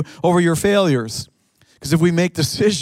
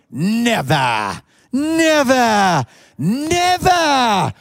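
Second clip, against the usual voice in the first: put-on rising Welsh accent, pitched higher each time; 6 semitones higher, much slower than usual. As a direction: mimic the pastor's wife's Welsh accent, climbing up. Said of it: "with a thick Wales accent".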